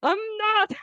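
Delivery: high, squeaky mock denial; mock indignant denial. Friend denying he echoes Russian talking points: high mock denial. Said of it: "And he was like".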